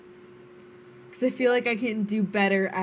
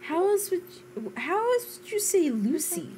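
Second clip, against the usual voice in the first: high-pitched, incredulous question; baffled protest; 9 semitones higher than usual. Real, not acted. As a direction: ask it in rising disbelief. Baffled arguing over saying a name.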